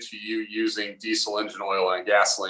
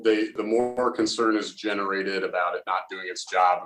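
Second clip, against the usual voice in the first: usual speaking voice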